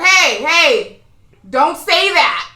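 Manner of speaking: high, loud, drawn-out protest. Retold or imitated. Lady at the back scolding the woman, high and drawn out. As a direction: imitate a lady calling out slowly from the back.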